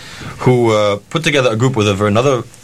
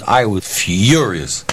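usual speaking voice

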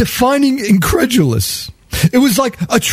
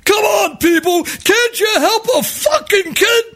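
angry yell; 11 semitones higher than usual. Retold or imitated. Panhandler yelling at riders who won't give. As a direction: bark at the whole car. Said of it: "he's like".

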